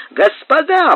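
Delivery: high call to the crowd. A narrator's reading. Captain calling to his neighbours, high calling voice.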